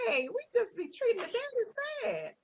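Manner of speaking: high, exaggerated mock complaint; mock outrage. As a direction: play up the disbelief in a high voice. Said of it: "I was like".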